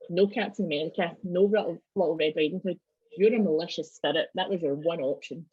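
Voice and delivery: normal talking voice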